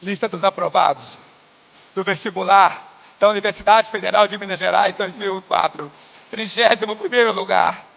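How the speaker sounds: measured proclamation with dramatic pauses; imitated momentous list reading; grand announcement. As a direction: announce the results like a ceremony, name by name. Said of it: "falou com efeito sonoro e tudo".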